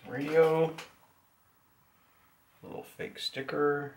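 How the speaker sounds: usual speaking voice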